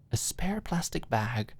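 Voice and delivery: ordinary delivery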